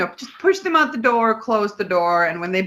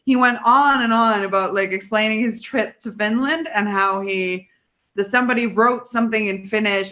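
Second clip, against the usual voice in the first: normal talking voice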